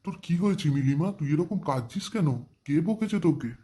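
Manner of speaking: high-pitched, worried questions; concerned questioning. Acted. Uncle asking why his niece cries, concerned questioning. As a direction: ask with alarmed concern.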